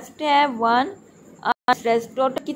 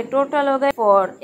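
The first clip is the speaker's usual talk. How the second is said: ordinary delivery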